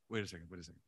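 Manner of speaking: low, softened self-check; stopping oneself. Acted. Preacher models checking an angry reaction, quietly stopping oneself. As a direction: drop down quietly to rein yourself in. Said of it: "You have to stop yourself and go".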